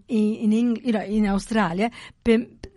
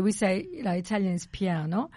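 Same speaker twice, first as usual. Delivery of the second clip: usual speaking voice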